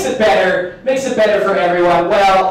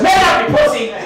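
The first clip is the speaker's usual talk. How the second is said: mock scolding shout; 8 semitones higher than usual. Imagined baby slaps and scolds dad.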